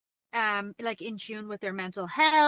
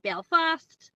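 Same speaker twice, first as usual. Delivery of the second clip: put-on Belfast accent with a raised, drawn-out ending; 6 semitones higher than usual. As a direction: say "Belfast" slowly, lifting the end. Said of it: "it's like, raised".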